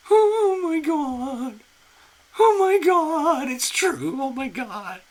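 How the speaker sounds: real high, loud squeals of disbelief; freaking out. Real, not acted. Host learns a big-name medium booked her, freaking out. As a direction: shriek with stunned, breathless delight. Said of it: "I'm freaking out here".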